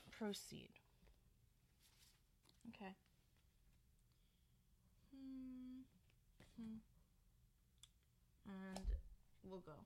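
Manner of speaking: soft breathy whisper. Real, not acted. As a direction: whisper the word. Hushed during card shuffling.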